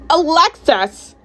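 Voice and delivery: high, drawn-out scolding yell; outraged teacher calling out a pupil; angry yell. A narrator's reading. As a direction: yell the student's name, loud and stretched. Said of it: "Art Emily yelled".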